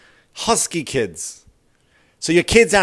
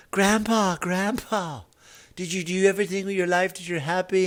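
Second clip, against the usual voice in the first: grandchild voice; 6 semitones higher, 6 dB quieter than usual. Retold or imitated. Grandchild at deathbed.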